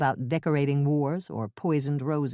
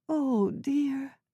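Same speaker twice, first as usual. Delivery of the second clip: put-on high, slow, worried elderly woman; Ethel's fretful old voice; 9 semitones higher, 3 dB quieter than usual. Old neighbor fretting to Jane over tea, high and slow. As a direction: quaver it like a flustered old neighbor.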